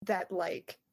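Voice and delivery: ordinary delivery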